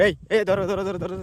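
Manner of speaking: quick loud shout to grab it; urgent calling out. Real, not acted. (জোরে চেঁচিয়ে ডাকা)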